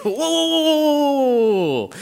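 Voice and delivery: long, loud whoa sliding down from high; high, urgent whoas run together, falling away; alarmed halting call. Acted. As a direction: call out to stop a crowd. Disciples halting the children.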